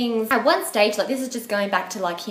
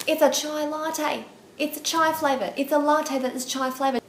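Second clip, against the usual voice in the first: exasperated explaining; 7 semitones higher than usual. Acted. Explaining chai latte to clueless date, exasperated. Spelling out obvious answer to date.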